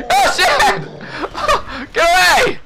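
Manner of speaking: high, laughing yell; laughing panic. Real, not acted. Player laughing as he shouts at the enemy.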